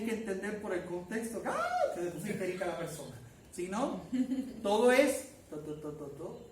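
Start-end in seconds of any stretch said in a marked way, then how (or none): 5.5-6.5 soft, level droning to to to; flat monotone. Shown as a demonstration. Teacher imitating text without exclamation marks, soft flat drone. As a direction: drone every syllable the same, no tune. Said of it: "to, plano, como [name]".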